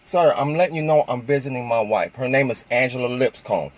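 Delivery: calm, steady and polite. Retold or imitated. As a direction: keep it level and patient. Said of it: "And I'm talking calmly".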